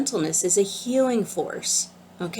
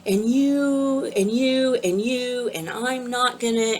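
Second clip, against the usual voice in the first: higher, mock angry vendor; mock angry yell; 5 semitones higher than usual. Mock yell of a vendor at the teacher's first event. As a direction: imitate a shrill complaint, half-hearted. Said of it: "she started yelling at me".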